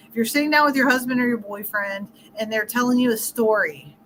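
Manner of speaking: ordinary delivery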